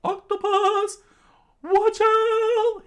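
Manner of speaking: very high, loud squeaky yell of warning; high-pitched warning cry. Acted. Character warns his friend of the octopus, squeaky high shout. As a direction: shriek the warning in a tiny high voice.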